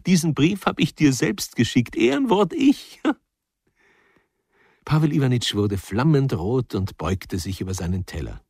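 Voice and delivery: high, giggly teasing wife voice; wife laughingly confesses her joke to the men. Acted. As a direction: gleefully reveal the prank.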